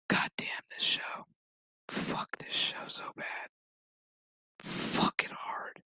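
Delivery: whispered cursing aside; exasperated whisper. Real, not acted. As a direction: whisper it to yourself, worn out. Whispered exasperated aside about the show.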